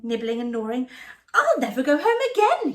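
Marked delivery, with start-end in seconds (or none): 1.3-2.7 high, loud laughing mouse; blissful sigh. Acted. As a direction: giggle with delight. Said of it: "he sighed".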